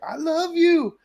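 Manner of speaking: very high, crying-like dog voice; high whimpering pup saying I love you; imitating dog saying I love you. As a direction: whine it out like a dog begging you to stay. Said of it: "you would hear that dog say".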